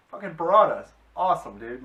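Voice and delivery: thrilled fan yelling about piranhas; real excitement. Real, not acted.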